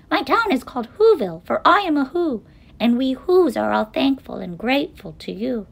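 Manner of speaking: high little mayor's voice; tiny mayor calling. A narrator's reading. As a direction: proudly pipe up to a giant. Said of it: "called the voice".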